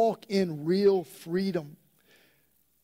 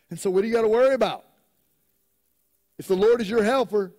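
louder, higher preaching, pressing each point; full-force preaching; 6 semitones higher, 5 dB louder than usual. Acted. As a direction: preach it out with rising fervor.

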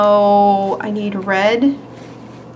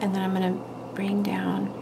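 whisper; 10 dB quieter than usual. Real, not acted. Whispering while painting.

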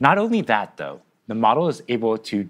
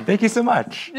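laughing; 12 semitones higher than usual. Real, not acted. Laughing after song.

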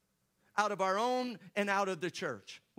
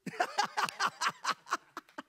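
high breathy laugh; 11 semitones higher than usual. Retold or imitated. Pastor laughing at news.

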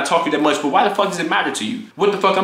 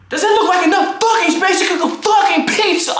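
furious yell; 13 semitones higher than usual. Retold or imitated. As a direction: rage at him over the counter space. Roommate fight about counter space.

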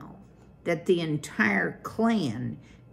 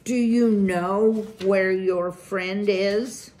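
higher, louder, slow stern elder voice; pressing question; 4 semitones higher, 7 dB louder than usual. A narrator's reading. Elder mouse pressing Poppy about her missing friend, stern. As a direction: pin her down before the crowd. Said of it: "Lungwort added".

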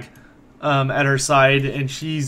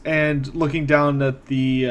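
ordinary talk, nothing marked

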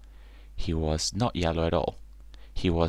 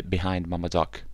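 plain reading voice

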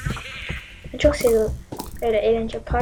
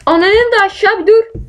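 angry yell; 5 semitones higher, 3 dB louder than usual. Real, not acted. Yelling at attacker.

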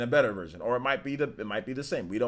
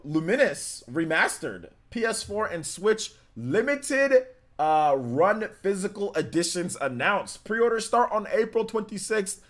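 higher, louder, slow announcer-like news read; headline read; 9 semitones higher, 5 dB louder, slower than usual. Real, not acted. Streamer announcing a game's physical release, headline read. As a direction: announce it slowly and loudly.

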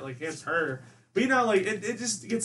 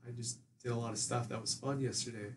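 much softer, low and cagey; mumbled evasive reply; 5 semitones lower, 10 dB quieter than usual. Retold or imitated. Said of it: "And I'm like".

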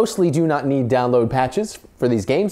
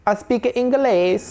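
failed Borat impression; 9 semitones higher, slower than usual. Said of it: "I was trying to do Borat".

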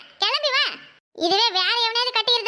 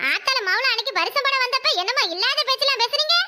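normal talking voice

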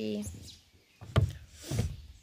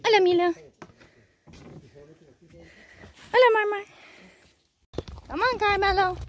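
high, loud calls to the animals; calling out; 9 semitones higher, 7 dB louder, faster than usual. Real, not acted. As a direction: call the animals over from afar.

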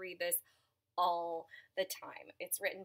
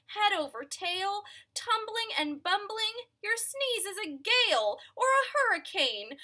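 high, loud pleading animal voice; pleading buffalo; 9 semitones higher, 6 dB louder than usual. Acted. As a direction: beg him not to sneeze.

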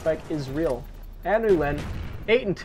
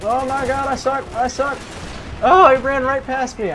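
high, loud outburst of self-blame; exasperated gamer shouting; frustrated yelling; 10 semitones higher, 9 dB louder than usual. Real, not acted. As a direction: yell in dismay at your own blunder. Player misses an easy kill.

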